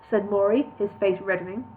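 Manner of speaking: ordinary delivery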